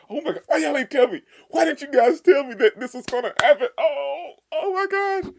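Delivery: high, loud, giddy squealing with laughter; excited squeal. Real, not acted. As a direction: squeal at friends who kept the secret. Fan reacting to the romance.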